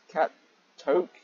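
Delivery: ordinary talk, nothing marked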